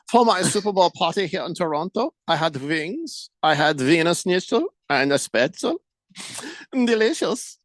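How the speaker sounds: hammed-up German accent, the w in "wings" said as v. Radio host reads a German listener's note, jolly German accent. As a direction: play a jolly German reading his own message aloud in a German accent. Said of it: "the most hilarious to hear in a German accent".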